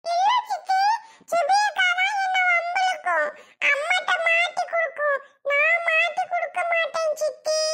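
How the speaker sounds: put-on very high child voice, sobbing protest; a small boy crying that he's innocent; crying little boy. Little boy protesting to his aunt while crying.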